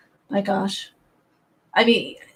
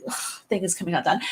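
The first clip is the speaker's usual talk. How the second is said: normal talking voice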